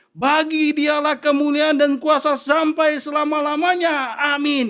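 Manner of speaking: high, loud, slow proclaiming; full-force preaching; preaching scripture. Acted. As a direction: proclaim the verse to the hall. Quoting scripture in praise.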